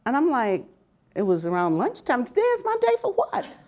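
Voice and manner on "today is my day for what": high, quick incredulous question; retold disbelief. Worker told today is her day, high mock disbelief. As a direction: shoot the question back, pitched way up.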